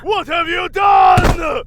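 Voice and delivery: very high, loud, slow roar; father roaring in grief on finding his dying son; anguished shout. Acted. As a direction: howl the question in grief.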